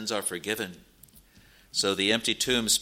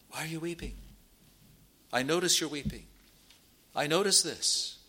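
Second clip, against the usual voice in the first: hushed, higher-pitched tender question, then gentle reassurance; tender care; 5 semitones higher, 3 dB quieter than usual. Retold or imitated. Jesus notices his people weeping, tender and caring. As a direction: softly show that you care. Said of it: "It shows his care for them".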